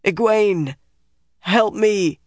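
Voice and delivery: high, drawn cry for help; desperate, pained call; pleading for help. Acted. Trapped man calls for help.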